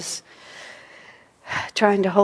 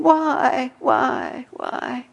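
high, plaintive repeated why; a lament of why, dying away; whining why; 7 semitones higher, much slower than usual. Retold or imitated. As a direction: plead like a wounded child. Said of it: "is very different than".

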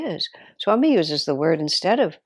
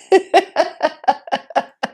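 high delighted laugh; 11 semitones higher, 4 dB louder than usual. Real, not acted.

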